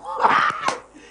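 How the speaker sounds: high breathy burst of laughter; amused laugh at a viewer's comment; real laugh. Real, not acted. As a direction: laugh out loud at the joke. Laughs at comment about record books.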